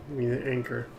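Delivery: usual speaking voice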